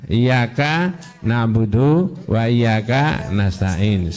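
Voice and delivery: slow, careful recitation; slow verse recital. Shown as a demonstration. Teacher reciting the verse as the Prophet was taught it, slowly. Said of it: "membacanya yang didahulukan apa?".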